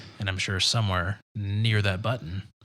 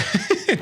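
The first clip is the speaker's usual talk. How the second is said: short high-pitched giggle; 5 dB louder than usual. Real, not acted. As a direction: let out a quick squeal of a laugh. Laughs at Dave's modesty.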